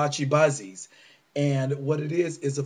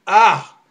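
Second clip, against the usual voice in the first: exasperated outcry; 5 semitones higher, 11 dB louder than usual. Real, not acted. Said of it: "I am so pissed".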